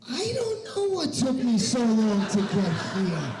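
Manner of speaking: gawking back at the plane, wowed; mock dazzled. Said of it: "I'm like".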